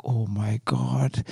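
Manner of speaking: low, drawn-out swoon; swooning reaction. Acted. Woman falling for a Spanish guy's broken English, swooning. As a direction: stretch it out slowly, deeply smitten. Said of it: "you're like".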